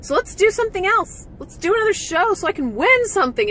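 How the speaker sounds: retelling telling the coach, a high petulant whine; whiny demand. Retold or imitated. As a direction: whine that you just want to win. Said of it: "I'm like".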